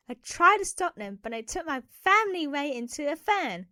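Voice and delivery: usual speaking voice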